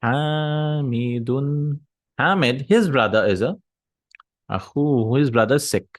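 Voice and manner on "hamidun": Hamidun drawn out very slowly, every syllable held; slow example word. Shown as a demonstration. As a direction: spell out Hamidun slowly like a teacher. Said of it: "for example".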